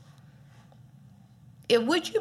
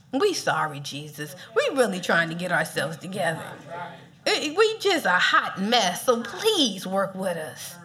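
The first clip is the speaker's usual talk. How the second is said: mock apology; 8 semitones lower than usual. Mock apology prayer to Jesus.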